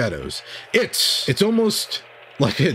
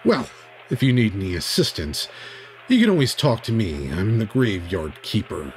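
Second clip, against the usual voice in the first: low, measured mysterious voice; graveyard keeper; 9 semitones lower, 3 dB quieter, slower than usual. Acted. Graveyard keeper offering assistance to travellers, low and ominous. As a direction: speak deep and slowly, offering help.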